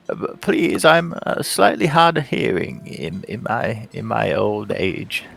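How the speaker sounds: put-on high, hard-of-hearing old man; frail elderly priest, leaning in.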